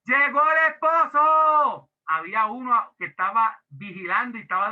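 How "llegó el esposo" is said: loud, high, slow announcing cry; shout. Retold or imitated. Watchman crying out that the bridegroom has arrived, loud and drawn out. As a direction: call it out to wake the whole house. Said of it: "aquel que gritó y dijo".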